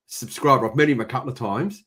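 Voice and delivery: usual speaking voice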